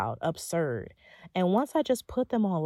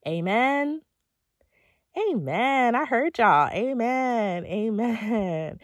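preacher's amen call; 4 semitones higher, 4 dB louder, much slower than usual. Acted.

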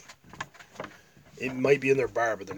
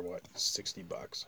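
hushed question; whispering; 5 semitones lower, 11 dB quieter, slower than usual. Real, not acted. Whispered aside about ticket price.

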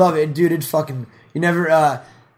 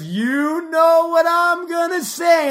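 higher, louder, slow drawn-out line; Louis drawing it out; slow Louis tease; 12 semitones higher, 5 dB louder, much slower than usual. Retold or imitated. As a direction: stretch every word teasingly. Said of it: "He goes".

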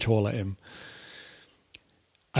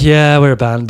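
exasperated admission; 4 semitones higher, 11 dB louder than usual. Retold or imitated. Admitting to cops.

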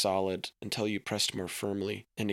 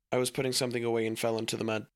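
blurted out very fast; boy speaking way too quickly; rushed excuse; 2 semitones higher, much faster than usual. Acted. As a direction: gabble the lie to get it over with. Boy lying in a rush.

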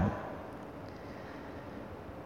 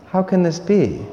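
wondering, open question; 7 semitones higher than usual. Retold or imitated. Priest voicing Mary's open wonder to the angel. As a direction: ask it in real wonder, wanting to understand. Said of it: "there's a little bit of a tone to that question".

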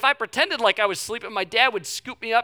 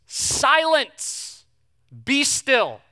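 rebuke; 6 semitones higher, 6 dB louder, much slower than usual. Acted. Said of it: "he rebuked the wind".

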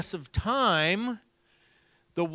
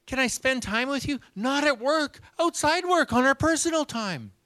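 excited Stanley; 8 semitones higher than usual. Retold or imitated. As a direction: gush like a thrilled kid with good news. Stanley excitedly telling news.

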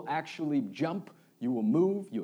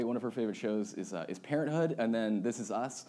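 ordinary talk, nothing marked

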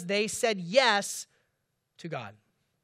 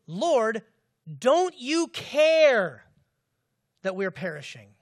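loud, drawn-out cry of hurt; anguished plea; 8 dB louder, much slower than usual. Retold or imitated. Disciples pleading with Jesus mid-storm, slow anguished cry. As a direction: stretch out don't you care in anguish. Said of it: "In Mark, it says".

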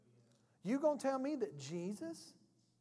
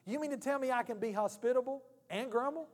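ordinary delivery

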